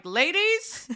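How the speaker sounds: high, rising singsong call; teasing high-pitched announcement; calling the girls. Retold or imitated. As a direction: summon friends with playful excitement. Acts out announcing to group chat.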